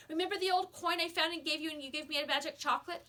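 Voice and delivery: put-on a frantic boy demanding an answer. A narrator's reading. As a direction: demand it, pushing hard. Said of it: "he demanded".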